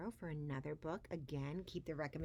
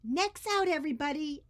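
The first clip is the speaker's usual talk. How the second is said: much higher and louder rallying call; exclaimed; 11 semitones higher, 9 dB louder than usual. Acted. Edwin rallies the caged Wiggleskins, loud. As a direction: rouse the whole cage. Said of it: "Edwin exclaimed".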